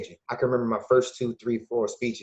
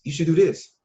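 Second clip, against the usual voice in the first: mock bossy advice; 6 semitones higher, 5 dB louder than usual. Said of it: "we all got the right answers".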